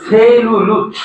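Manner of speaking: "সেলুলোজ" slowly, stretched as seee-lu-looch; drawn-out pronunciation demo. Shown as a demonstration. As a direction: stretch "সেলুলোজ" with a clear s and ch-like ending. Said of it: "দন্ত স কে চ উচ্চারণ করছি".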